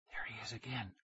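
hushed, voiceless whisper. A narrator's reading. Nicky spotting the man following them, whispering. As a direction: whisper it so the follower won't hear. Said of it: "Nicky whispered".

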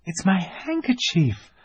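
put-on very high, delighted little girl. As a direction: gasp of recognition, girlish. Lucy voiced as a little girl.

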